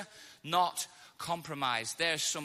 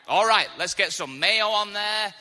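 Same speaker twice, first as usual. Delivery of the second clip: loud, higher mock enthusiasm; eager acceptance; 4 semitones higher, 7 dB louder than usual. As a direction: dive in loudly, suddenly game for it. Starving guest now eager to eat.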